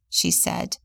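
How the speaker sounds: ordinary delivery, nothing marked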